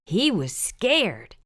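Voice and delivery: usual speaking voice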